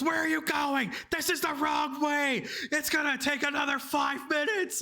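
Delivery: high-pitched indignant outburst; angry passenger. Retold or imitated. Drunk passenger berating her driver over the route, angry high-pitched imitation.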